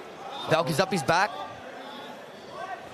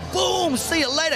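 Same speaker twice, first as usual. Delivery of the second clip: cocky voiced quote; 14 semitones higher, 3 dB louder than usual. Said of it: "He's like".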